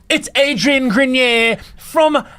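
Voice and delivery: put-on high, slow, drawn-out New Zealand accent; rough New Zealand accent. Tour guide spotting a celebrity from the jeep, mock New Zealand accent. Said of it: "this is my sort of New Zealand accent".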